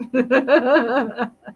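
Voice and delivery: delighted laugh. Real, not acted. Host laughing at a viewer's hug request, delighted laugh. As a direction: laugh warmly at the joke.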